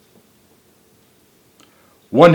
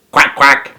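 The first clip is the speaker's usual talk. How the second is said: duck quacking; 2 semitones higher, 4 dB louder than usual. A narrator's reading. Duck answers the phone.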